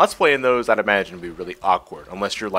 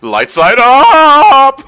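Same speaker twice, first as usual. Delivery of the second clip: high, slow, laughing shout; 14 dB louder, much slower than usual. Pretend. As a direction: belt it out while laughing. Joking castle order, shouted.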